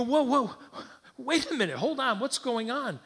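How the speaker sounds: loud, high-pitched acted alarm; alarmed protest. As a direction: stop them urgently, as a panicked father would. Father objects as son is grabbed.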